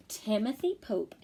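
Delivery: ordinary talk, nothing marked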